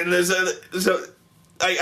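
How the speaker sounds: usual speaking voice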